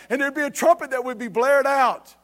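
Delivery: high, forceful sermon storytelling; impassioned preaching. Real, not acted. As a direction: preach the alarm scene with urgency.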